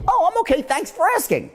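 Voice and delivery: high-pitched, eager-to-please thanks; disarmed polite reply. Retold or imitated. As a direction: answer high and politely, won over. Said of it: "So they'll be".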